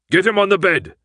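high, loud urgent order; shouted instruction; yelled. Acted. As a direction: bark the command to the others. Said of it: "Hans yelled".

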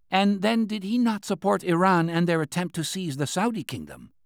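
lightly voiced anxious official; Qian's voice. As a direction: press the point like a worried old minister. Qian speaks at length.

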